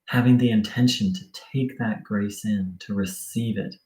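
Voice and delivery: sparse, subdued meditation cues with long silences; guided meditation. Acted. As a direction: ease them back into stillness.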